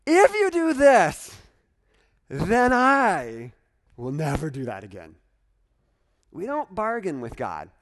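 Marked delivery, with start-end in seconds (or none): 0.0-5.1 mock bargaining. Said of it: "we don't have to bargain".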